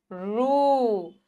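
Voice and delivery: रू held long and slow; long drawn vowel. Shown as a demonstration. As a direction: stretch the oo in रू. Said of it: "खींच रहे हैं थोड़ी मात्रा को". (रू को खींचकर लंबा बोलें)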